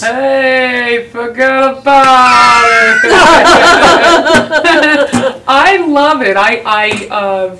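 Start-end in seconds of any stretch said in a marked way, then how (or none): 0.0-3.1 drawn-out Long Island "forget about it"; playful Long Island accent. Shown as a demonstration. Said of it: "that Long Island accent". 3.1-5.4 loud high laughing burst; real loud laugh; burst of laughter. Real, not acted. Laughs after her accent line.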